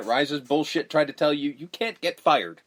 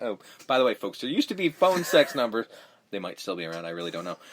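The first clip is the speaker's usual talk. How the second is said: ordinary talk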